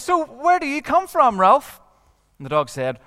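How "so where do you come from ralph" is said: put-on curious boy. Eric asks the dog.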